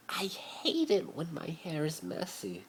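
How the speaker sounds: high, slow, whispered whine. A narrator's reading. Draco complains to Harry about messy hair, whining. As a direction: whimper it like a spoilt child. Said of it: "Draco started to whine".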